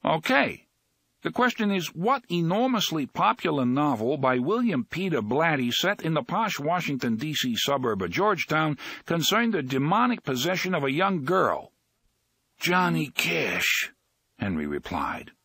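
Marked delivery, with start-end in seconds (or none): 0.0-11.7 quizmaster. Acted. Quizmaster reading question. 12.6-13.9 sleepy answer. Acted. Sleepy Henry answers slowly.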